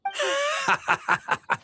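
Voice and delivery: teasing run of chuckles; amused laugh. Acted. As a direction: chuckle at someone's startled reaction. Laughs at Jean's startled reaction.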